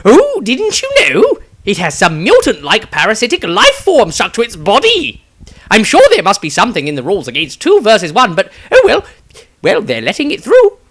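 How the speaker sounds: high, loud, eager wizard voice; thrilled wizard spilling news; excited wizard. Acted. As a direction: gush the gossip excitedly. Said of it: "Maestro excitedly began".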